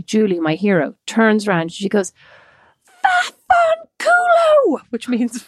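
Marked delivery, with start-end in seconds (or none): 3.0-4.8 put-on high, slow Irish-accented yell of Italian. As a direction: stretch it out in a broad Irish accent, crying out. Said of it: "in her most Irish accent".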